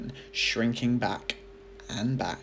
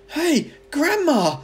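much higher, louder warning yell; alarmed shout; 14 semitones higher, 11 dB louder than usual. A narrator's reading. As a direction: shout up at her to stop. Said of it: "George gave a jump and shouted".

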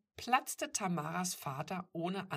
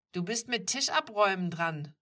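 loud, higher brotherly grumble; raised, sulky protest; grumbling; 4 semitones higher, 8 dB louder than usual. A narrator's reading. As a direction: complain that it is someone else's turn. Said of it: "maulte Mika".

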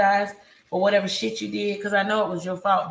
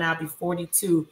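normal talking voice

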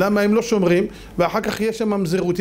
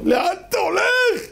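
high, drawn-out cry of a father; father screaming; 12 semitones higher than usual. Acted. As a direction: call desperately after a straying son. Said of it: "ואבא צורח". (צעקת אב גבוהה ומשוכה)

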